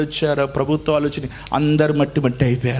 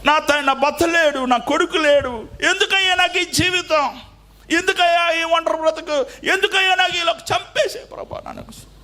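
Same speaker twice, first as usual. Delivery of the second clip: widow's lament; 12 semitones higher than usual. Retold or imitated.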